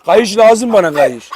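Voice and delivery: ordinary delivery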